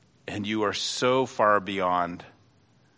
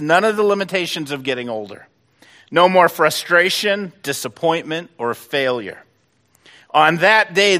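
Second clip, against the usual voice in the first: loud, high, ringing pulpit proclamation, each no more hammered home; fiery preaching voice, swelling then easing off at the end; preaching at full force; 7 semitones higher, 9 dB louder than usual. Acted. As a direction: build the crowd up with a rising preacher's cry. Preaching the coming day.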